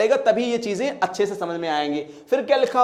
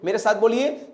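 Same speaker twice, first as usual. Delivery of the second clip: ordinary talk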